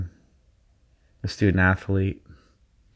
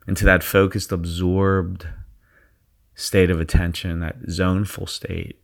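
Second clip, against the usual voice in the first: guided meditation. Acted. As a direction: ease them into their zone. Leads a guided visualization.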